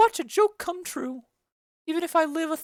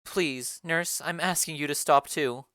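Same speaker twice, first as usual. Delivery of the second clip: low, commanding lady of the house; a noble mother summoning the nurse, lower; Lady Capulet; 12 semitones lower than usual. Acted. Lady Capulet calls the nurse for her daughter, low and commanding.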